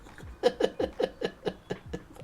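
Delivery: real wheezing laugh. Real, not acted. Viewer cracking up at the scripted scene, laughing. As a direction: laugh helplessly in short bursts.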